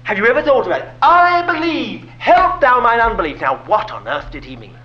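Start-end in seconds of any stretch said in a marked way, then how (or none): 1.0-3.4 crying out. Retold or imitated. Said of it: "the Father cried out".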